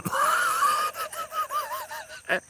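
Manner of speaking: long, high-pitched laughing fit; real laugh. Real, not acted. Laughing over hollering at two arguing hunters, high cackling laugh. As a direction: laugh hard and let it run on. Laughs after retelling the prank.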